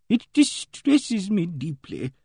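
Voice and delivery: high, very slow lady's voice; distressed Emily Barton. A narrator's reading. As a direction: draw out each word in distress. Distressed lady voiced in story.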